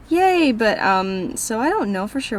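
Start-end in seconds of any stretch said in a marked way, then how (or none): 0.1-0.5 mock cheer. Real, not acted. Sarcastic cheer about having job.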